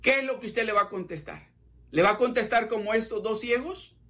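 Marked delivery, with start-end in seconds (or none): none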